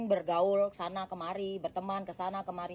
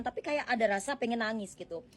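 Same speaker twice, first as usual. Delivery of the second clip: normal talking voice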